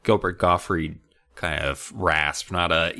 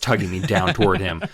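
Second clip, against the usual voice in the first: normal talking voice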